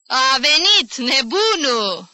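high, loud, drawn-out cry; piqued voice. A narrator's reading. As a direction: call it out slowly, stung and indignant. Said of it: "se auzi atunci glasul înțepat".

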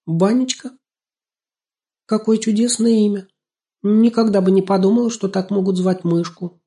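put-on very high, wondering girl's voice; delighted surprise. A narrator's reading. Girl hearing the mouse's name, surprised and delighted. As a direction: marvel at a charming name.

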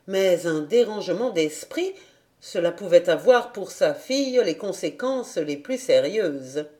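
plain reading voice

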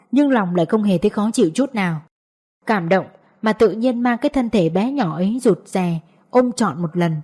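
ordinary delivery

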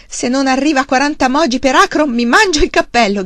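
loud, high, exuberant boast; excited shout. A narrator's reading. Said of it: "gridò".

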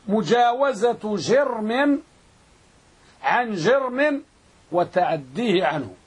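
slow phrases with pauses between; measured pace for students writing it down; slow dictation. Shown as a demonstration. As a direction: dictate it in chunks, stretching each word. Teacher dictating the definition in chunks.

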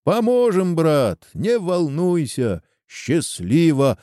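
high, drawn-out farewell call; crowd's mild parting shout; calling after him. A narrator's reading. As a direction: send the call after someone leaving. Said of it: "кричали ему вслед гости".

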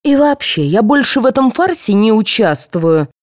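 high, huffy announcement; indignant. A narrator's reading. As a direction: declare you are through with them. Aida quits the play in anger.

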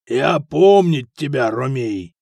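slow, heavy, loud foreign figure; foreign Dane mercenary. A narrator's reading. Dane recognizes the Roman at the table, slow foreign mercenary. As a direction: draw out the broken words with weight. Dane speaking broken language.

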